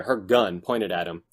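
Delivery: normal talking voice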